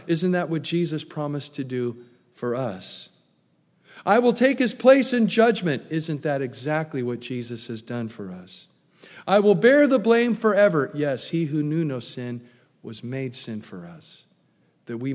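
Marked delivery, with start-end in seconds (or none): 4.0-5.8 ringing vow. Acted. Preacher voicing Judah's vow. 9.3-10.9 ringing vow. Acted. Preacher voicing Judah's vow.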